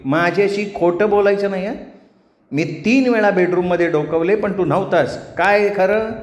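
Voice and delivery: high, scolding wife's voice; suspicious wife. Acted. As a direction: catch him lying and press for the truth. Voices Gauri interrogating Ganya. (जाब विचारणारी बायको, चढ्या आवाजात)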